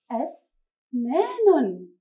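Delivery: أسنان read very slowly, each sound apart. Shown as a demonstration. Teacher reading أسنان to children, very slow. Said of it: "دعونا نقرأ هذه الكلمة".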